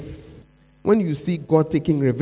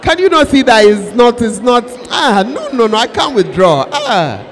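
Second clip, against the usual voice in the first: loud, pleading preaching with cries of anguish; preaching at full force; 7 dB louder than usual. Acted. Preacher voicing a believer who cannot let go, loud and anguished. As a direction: plead it out to the hall like it hurts.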